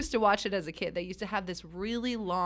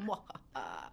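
slow theatrical villain menace; 9 semitones lower, 11 dB quieter, slower than usual. Pretend. Evil laugh after a pause.

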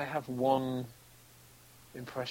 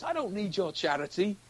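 indignant, higher-pitched tramp refusal; acted tramp; 8 semitones higher than usual. Actor retelling a tramp's line from the film, indignant. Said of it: "he just comes up to me and he goes".

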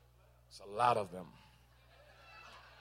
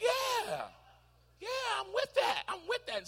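higher, light eager agreement with a laugh; eager servant; 12 semitones higher, 4 dB quieter, slower than usual. Retold or imitated. Servant agreeing to the king's assignment, higher and eager. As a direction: chirp your yes like a pleased servant.

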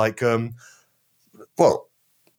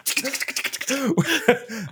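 high laugh; 12 semitones higher than usual. Real, not acted. Bursts out laughing.

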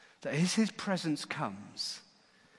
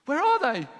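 high, loud baffled question; astonished, pitched-up outburst; alarmed demand; 12 semitones higher, 7 dB louder than usual. Acted. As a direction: demand an answer in disbelief. Priests demand missing prisoners.

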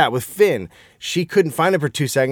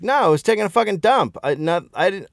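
put-on higher, unbothered little boy; little kid voice; 7 semitones higher than usual. Dad voicing his son defending himself, higher kid voice. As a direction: voice the small son, higher and matter-of-fact. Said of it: "But Finn is like".

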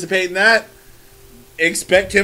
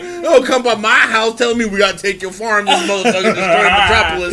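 loud, higher-pitched indignant rant; ranting mock outrage; 4 semitones higher, 6 dB louder than usual. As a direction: lay into them like a fed-up parent. Acting out Pa Kent's angry rant.

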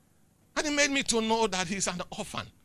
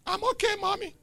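put-on child's reply; 14 semitones higher than usual. Preacher imitating a child answering his mother, high and small. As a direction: answer like a small obedient kid.

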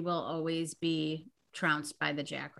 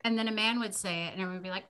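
ordinary talk, nothing marked

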